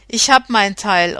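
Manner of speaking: ordinary delivery, nothing marked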